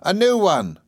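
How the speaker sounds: high nurse announcing news, falling away; very high, brightly insistent nursemaid; Peggotty. A narrator's reading. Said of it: "said Peggotty".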